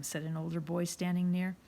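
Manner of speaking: ordinary delivery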